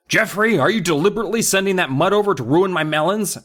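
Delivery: high, fast accusing call. Acted. Neighbor calling over the fence to Dad, accusing. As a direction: fire it across the yard. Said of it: "Mister McCall called".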